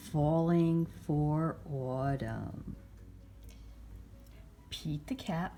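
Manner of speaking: usual speaking voice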